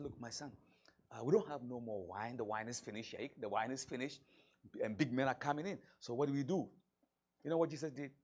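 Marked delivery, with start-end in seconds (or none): 0.0-6.7 mother pleading in private. Acted. Mary pleads privately with Jesus.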